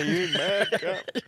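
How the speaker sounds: slow, high, laughing through it; laughing mock greeting. Echoing the mate greeting, slow and laughing. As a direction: drawl it out while cracking up.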